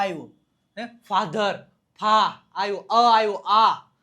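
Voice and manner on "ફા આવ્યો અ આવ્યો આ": ફા, then આ, open vowels hit hard; vowel sound demonstrated. Shown as a demonstration. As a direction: loudly sound out the long aa in ફા and આ. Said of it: "શું આવ્યો?". (ફા અને આ પર ભાર દઈને ઉચ્ચાર)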